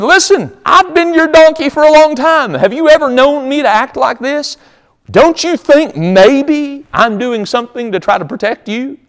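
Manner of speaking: comic donkey voice, very high-pitched; talking donkey. Acted. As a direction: plead indignantly as a wronged donkey, way up high. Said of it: "The donkey turns around and goes".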